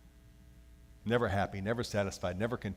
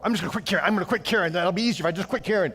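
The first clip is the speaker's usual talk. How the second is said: high, loud careless whine; mock careless; 8 semitones higher, 8 dB louder, faster than usual. Pastor mocking someone giving up on caring, high whine. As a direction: shrug it all off out loud. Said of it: "the attitude that says".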